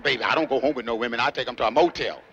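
low, easy reply to a come-on; smooth brush-off. Retold or imitated. Man answering a young lady's invitation, smooth and low. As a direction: toss it back low and unhurried.

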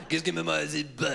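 short burst of French-sounding gibberish; mock French noises. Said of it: "I'd just make French noises".

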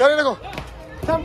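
usual speaking voice

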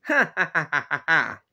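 staccato ha-ha-ha of a prankster; mocking fake laugh. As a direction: laugh deliberately at your victims. Prankster mocks the victims.

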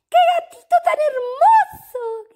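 put-on high excited girls; delighted squeal. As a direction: squeal with joy over a kitten. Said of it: "gritaron todas".

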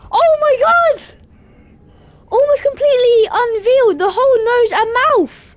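high loud amazed shouting; excited shock. Real, not acted. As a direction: exclaim in shocked delight. Shocked by chef's face.

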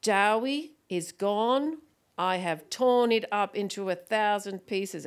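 put-on slow, grand child's proclamation; proud little boy. Small son announcing his name change, slow and commanding. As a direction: declare it with puffed-out chest, word by weighty word. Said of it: "puffed out his little chest and he said".

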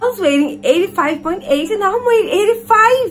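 high, loud voice cracking into tears; real distress over the scale, almost crying; near tears. Real, not acted. Shocked at weigh-in result.

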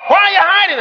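high, calling question; God questioning. Acted. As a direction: call it across to someone hiding. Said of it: "God is pretending".

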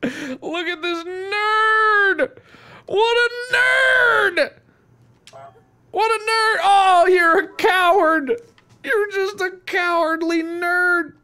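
very high, drawn-out mockery; slow, squealed heckling; jeering taunt. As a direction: stretch the insult out in a high squeal. Taunting a fleeing character as goose.

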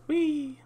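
very high, drawn-out whee; playful whee. Real, not acted. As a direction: squeal a long, piping whee. Playful cry while flying in game.

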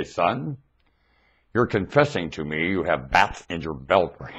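imitating dad. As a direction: scold like a dad about to take the belt off. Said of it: "my dad would say".

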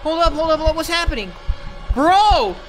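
rising panic, high and loud; startled gamer panicking; panicked. Real, not acted. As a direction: stammer it out in alarm. Panic at jump scare.

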